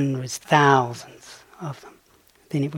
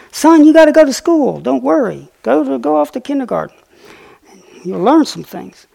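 much higher and louder, a motherly squirrel; 11 semitones higher, 9 dB louder than usual. Retold or imitated. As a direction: play the mama squirrel reassuring her little one, pitched way up. Mother squirrel comforting her son.

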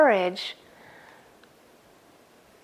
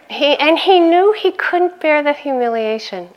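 ordinary delivery

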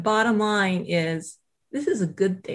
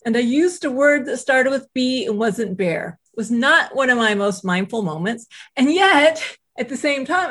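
normal talking voice

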